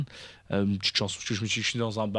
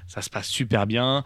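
normal talking voice

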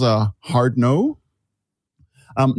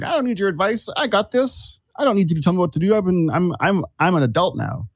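defiant inner retort; 6 semitones higher than usual. Retold or imitated. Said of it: "I was like".

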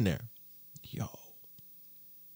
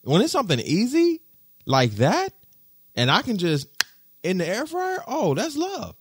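gushing; 14 semitones higher than usual. Real, not acted. Gushing about the air fryer.

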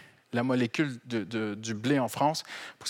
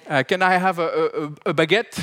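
mock English tourist ordering bread, a bit higher; tourist accent; 3 semitones higher, 4 dB louder than usual. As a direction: ham up a lost anglophone tourist. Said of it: "on les entend". (touriste anglophone imité, accent anglais appuyé)

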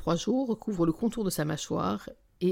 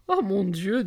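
breath-laden, helpless amusement; crying with laughter; 2 semitones higher, 4 dB louder, slower than usual. A narrator's reading. Narrator reacts to friend's rude image, crying with laughter. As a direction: say it through tears of laughter. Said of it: "dis-je en pleurant de rire".

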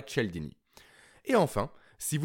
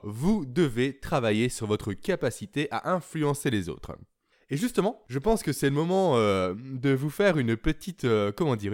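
normal talking voice

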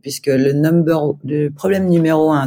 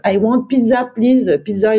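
put-on pitched up, French-accented broken English; struggling beginner English; 7 semitones higher than usual. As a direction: plead for pizza in halting English. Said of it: "je disais".

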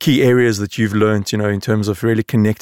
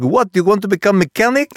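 laughing, high Lebanese uncle voice; hammed-up Lebanese accent, laughing through it; bad Lebanese accent; 10 semitones higher than usual. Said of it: "I do a very bad Lebanese accent".

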